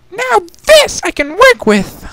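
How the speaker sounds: high, slow, savoring delight; theatrical high-pitched glee; delighted Rarity. Acted. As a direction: relish it loudly in a high lady voice.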